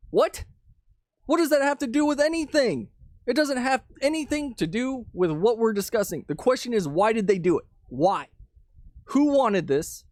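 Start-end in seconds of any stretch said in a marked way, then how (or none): 0.1-6.2 high-pitched exasperated objection; indignant, pitch jumping up; incredulous. Real, not acted. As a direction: push back in baffled disbelief. Answering an imagined accuser, baffled.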